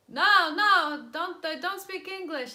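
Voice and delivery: hammed-up urgent no; mock flustered locals. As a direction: locals shouting back at a lost driver. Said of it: "And they were like".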